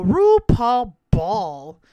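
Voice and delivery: loud slow title reveal; loud drawn-out title; announced title. Acted. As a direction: present the episode name.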